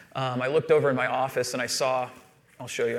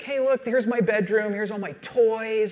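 higher-pitched imitation of a proud little girl; excited young daughter; 7 semitones higher than usual. Daughter showing her friend round the house, excited imitation. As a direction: show off like an eager kid, voice lifted. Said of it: "and she's like".

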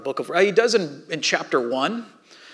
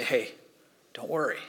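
gentle comfort; 3 semitones lower, 7 dB quieter than usual. Acted. God comforting the hurting.

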